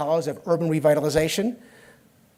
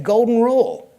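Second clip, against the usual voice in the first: emphatic declaration; 5 semitones higher, 7 dB louder than usual. Retold or imitated. Insisting on name in argument.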